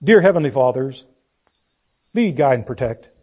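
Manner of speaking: a lightly mocking, half-hearted prayer; mock rote prayer. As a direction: rattle it off like a bored bedtime prayer. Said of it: "This was not one of those".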